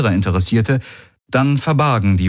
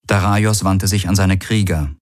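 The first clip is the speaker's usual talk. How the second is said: ordinary delivery, nothing marked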